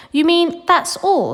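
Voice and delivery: slow, high and loud, let down; a boy sneering at a tiny strip of gum; disgusted. A narrator's reading. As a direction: drag it out in scornful disbelief.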